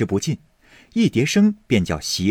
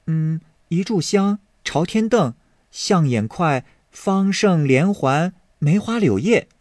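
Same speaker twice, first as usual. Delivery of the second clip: put-on lightly raised girlish voice, listing patterns; a hint of a sweet young maid; 7 semitones higher than usual. Maid Yinger listing knot patterns to Baoyu, lightly girlish.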